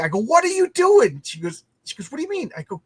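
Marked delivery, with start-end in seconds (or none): 0.2-1.2 high, loud, drawn-out mock horror; stretched-out disbelief, pitched way up; appalled outburst. Retells horror at jarred gravy. 2.1-2.5 high-pitched imitation of a puzzled girlfriend; retold bewildered question in a high voice; baffled retort. Retells her baffled reply.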